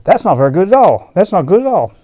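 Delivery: loud, high protest; loud dismay. Acted. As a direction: shout the complaint. Character reacts loudly in story.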